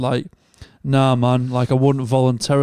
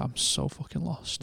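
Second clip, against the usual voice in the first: much softer, slow and deflated; quiet, lost mumble; 13 dB quieter, slower than usual. Retold or imitated. The released inmate admitting he is lost, quietly mumbled. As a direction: let it sink, barely audible. Retelling a lost man's words.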